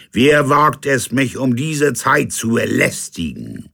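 high, loud demanding captain; indignant captain. Acted. Captain challenges whoever knocks, high and loud. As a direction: challenge the intruder.

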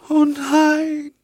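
a moan that leaps up high on "oh"; rising cry. A narrator's reading. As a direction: let "oh" break upward into a cry. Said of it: "stöhnte das Mädchen". (hoher, klagender Aufschrei auf "oh")

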